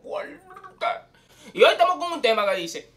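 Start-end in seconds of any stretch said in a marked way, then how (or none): 0.0-0.9 airy whisper. Acted. Intro catchphrase, whispered tag.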